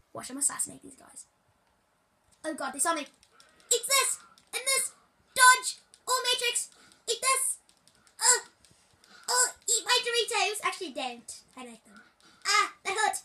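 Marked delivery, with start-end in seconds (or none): 3.0-10.7 high excited gamer yells; battle cries. Pretend. Kid cheering on his in-game fight, high battle cries. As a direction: throw each line like a punch.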